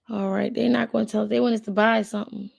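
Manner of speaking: usual speaking voice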